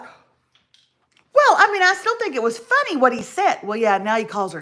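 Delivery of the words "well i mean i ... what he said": high, fast, lightly voiced TV host; mock a chirpy co-anchor defending the joke; a hint of Hoda, pitched up; imitating Hoda. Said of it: "Hoda was like".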